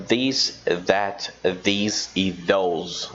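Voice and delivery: this, that, these e those spaced out, these and those dragged very slowly; teacher drilling the four words; careful demo pronunciation. Shown as a demonstration. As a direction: spell out each th word slowly. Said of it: "exagerando na pronúncia".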